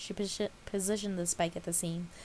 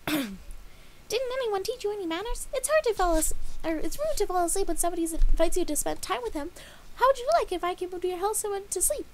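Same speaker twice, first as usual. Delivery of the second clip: high, loud scolding pony voice; frustrated Pinkie; 13 semitones higher, 7 dB louder than usual. A narrator's reading. Pinkie scolding Dash for falling asleep, high and loud. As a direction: scold her like a put-out party pony. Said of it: "Stomping her hooves, the frustrated Pinkie lashed out".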